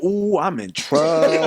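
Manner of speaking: slow, drawn-out realization; slow dawning dread. Retold or imitated. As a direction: let it sink in slowly. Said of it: "that's when it hit me".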